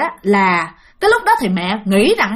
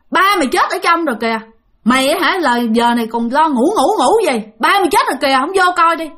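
higher, raised voice, scolding her harshly; retold angry scolding; 5 semitones higher than usual. Stranger in the night scolds mother that her father died, angry higher voice. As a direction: bark it at her like a stranger cursing. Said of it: "cái cuối cùng ổng mới chửi".

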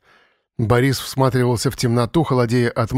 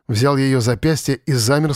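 usual speaking voice

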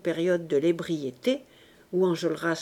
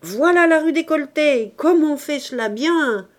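crié fort et bien plus aigu; ravi, il admire la barricade; cri enthousiaste; 6 semitones higher, 6 dB louder than usual. A narrator's reading. (Bahorel s'extasie en criant)

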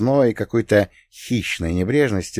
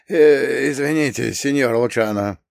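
guilty, hesitant apology in a higher voice; sheepish mumble; 4 semitones higher than usual. A narrator's reading. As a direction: mumble it like a scolded underling. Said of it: "виновато насупился, опустил ствол и тихо пробормотал".

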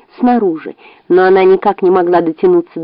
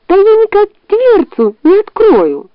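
high fox voice; 12 semitones higher than usual. Acted. Fox protests to bear.

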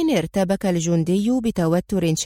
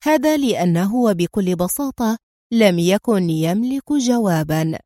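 ordinary delivery, nothing marked

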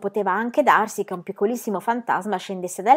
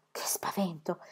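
plain reading voice

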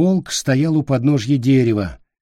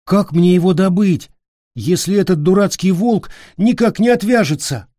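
put-on high, whining child voice; complaining boy; 7 semitones higher than usual. A narrator's reading. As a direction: whine about the wolf. Peter complains.

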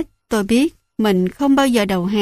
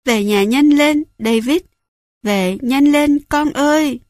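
louder, higher, breaking cry to come home; she's desperate now, pushing harder; frantic sobbing plea; 5 semitones higher, 3 dB louder than usual. A narrator's reading. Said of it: "Bà nức nở".

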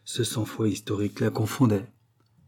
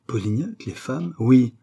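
higher, emphatic royal exclamation; acted outburst, pitched up; exclaimed; 4 semitones higher, 6 dB louder than usual. A narrator's reading. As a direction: confirm it with a lively cry. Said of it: "s'écria le roi".

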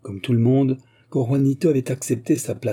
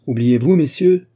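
much louder rebuke; sharp exclamation; 4 semitones higher, 7 dB louder than usual. A narrator's reading. General to drunken officers, a loud stern rebuke. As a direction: call the officers to order. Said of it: "s'écria le général".

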